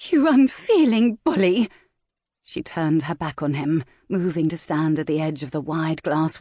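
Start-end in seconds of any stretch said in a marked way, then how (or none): 0.1-1.7 louder and higher, near tears; tearful furious cry. Acted. Valentine yells at the rake through tears, louder and higher. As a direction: hurl the insult through tears.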